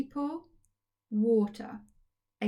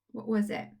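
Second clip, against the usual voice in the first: ordinary talk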